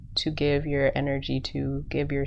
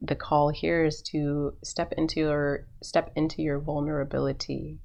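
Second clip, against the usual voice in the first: usual speaking voice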